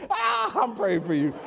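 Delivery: high laugh breaking into speech. Real, not acted. Preacher teases congregant who said preach, laughing.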